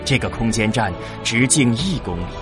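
ordinary talk

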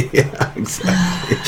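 real laugh, words breathed through it; laughing agreement. Real, not acted. Preacher amused by co-speaker's slot machine quip, laughing.

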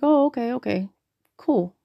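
high, lightly acted acceptance, coming down; retold surprised agreement. Agreeing to be in a pamphlet, retold surprise. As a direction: act out going along with it.

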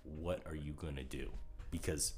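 ordinary delivery

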